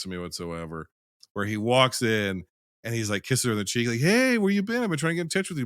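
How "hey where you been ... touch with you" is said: high-pitched hammed-up hello; breezy, high-pitched acted catching-up; mock eager greeting. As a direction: imitate an over-friendly greeting, pitched way up. Acts out film character's casual greeting.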